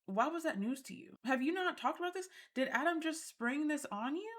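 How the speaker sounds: high-pitched, disbelieving questions; incredulous questioning. Acted. Host challenging Marissa over surprise news, incredulous. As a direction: press them in mock disbelief.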